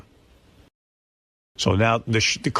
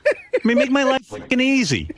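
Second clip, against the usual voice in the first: exasperated rant; 11 semitones higher than usual. Retold or imitated. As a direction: vent at him like he's driving you nuts. Retelling yelling at Ronnie.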